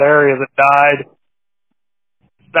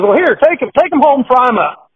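put-on lightly raised kid voice; boy handing over fish; 7 semitones higher than usual. Boy gives the priest his catch, a hint of a kid's voice. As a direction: offer the fish brightly like a little boy. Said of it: "He says".

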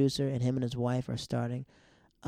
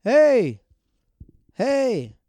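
high, loud, drawn-out holler; retold calling out; 11 semitones higher, 12 dB louder, much slower than usual. Person knocking on the window for help, imitated loud holler. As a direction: imitate someone yelling through a window. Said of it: "hear this person knocking on the window going".

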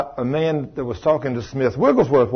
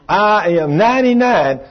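strong proud voice; 5 semitones higher, 3 dB louder than usual. Retold or imitated. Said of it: "she sounded strong".

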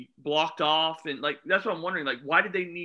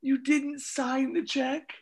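disbelief; 8 semitones higher, slower than usual. Real, not acted. Friend in disbelief.

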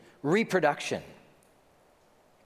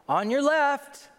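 high, slow call to walkers ahead; high drawn-out call to clear the path; 12 semitones higher, 3 dB louder, slower than usual. Retold or imitated. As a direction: sing out a polite warning from behind. Calling out to pass walkers.

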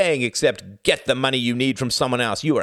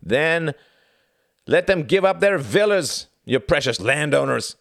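lazy cry; 10 semitones higher, 6 dB louder than usual. A narrator's reading. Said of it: "cried one Nazi lazily".

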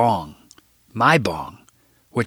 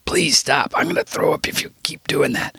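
begging; 5 semitones higher than usual. A narrator's reading. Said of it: "I begged him again".

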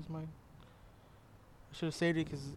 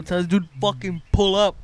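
loud; 3 semitones higher, 7 dB louder, slower than usual. Real, not acted. Urging friend to join, loud.

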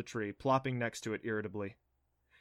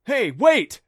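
loud high call after someone; 10 semitones higher, 16 dB louder than usual. Acted. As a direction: shout for her to wait. Calling after her.

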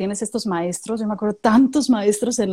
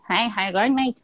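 mock Australian mumble; slower than usual. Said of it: "pues, hablan así".

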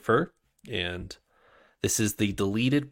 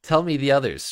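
eager demand, higher and louder; urgent plea; 6 semitones higher, 6 dB louder than usual. Acted. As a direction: press him for the answer. Shai pleads urgently.

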